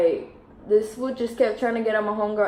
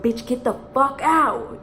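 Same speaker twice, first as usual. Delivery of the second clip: higher, drawn-out retold command; emphatic acted urgency, slow and high; urgent order; 5 semitones higher than usual. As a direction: order your friend out, stretching the words. Retold order to flee the car.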